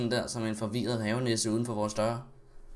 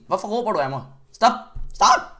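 raised voice building to a shout; mock angry shouting; 9 semitones higher, 10 dB louder than usual. Streamer tells imagined shouting viewers off, mock yelling. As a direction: build to a yelled mock protest.

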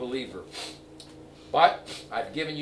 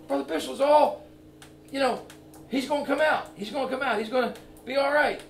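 brightly confident, pitched higher; all positive; 10 semitones higher than usual. Retold or imitated. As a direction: mimic a friend's upbeat assurance. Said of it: "He's, like, all positive".

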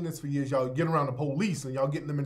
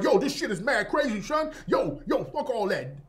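higher-pitched, exaggerated Brooklyn street accent; hammed-up Brooklyn impression, pitched way up; mock Brooklyn accent; 7 semitones higher, 3 dB louder than usual. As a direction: ham up a Brooklyn accent in mockery. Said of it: "women from Brooklyn, they ain't walking around".

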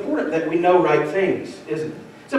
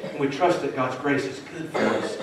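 ordinary delivery

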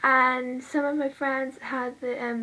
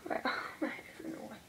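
whispered laugh; 9 dB quieter, much faster than usual. Real, not acted. Laughing while doing makeup.

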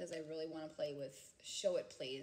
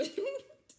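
short high laugh; 4 dB louder than usual. Real, not acted. Laughing at her own thought.

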